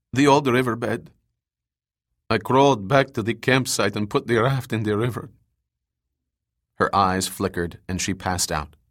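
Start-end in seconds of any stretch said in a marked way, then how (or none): 0.1-5.3 lightly voiced wounded survivor; injured woman. A narrator's reading. Wounded survivor telling rescuers of an abduction, lightly voiced. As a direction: play the hurt scientist with a slight change of voice.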